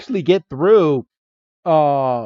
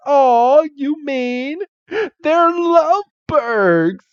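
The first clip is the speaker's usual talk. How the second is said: much higher, slow, cooing villainess; high mock Jessie; 12 semitones higher, much slower than usual. Retelling Jessie teasing Ash and Misty, high mock coo. Jessie voiced in retold scene.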